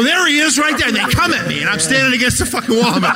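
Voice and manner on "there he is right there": high, louder pointing yell; mock shout. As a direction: call out the comic to the gang. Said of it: "They go".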